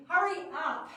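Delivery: loud, high hurry-up shout; shouted command. Shown as a demonstration. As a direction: bark it at the kids to hurry. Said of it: "Yep, I'm still not grumpy".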